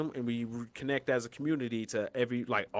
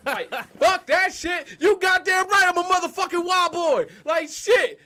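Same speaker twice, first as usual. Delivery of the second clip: wild yell; 9 dB louder than usual. Pretend. Continues wild yelling, laughing.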